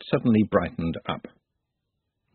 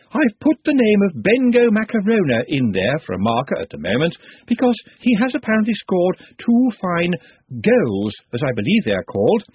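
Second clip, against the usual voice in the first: high, eager music professor; lightly voiced excitable professor; thin reedy voice; 9 semitones higher, 3 dB louder than usual. Ritornello introducing the chant.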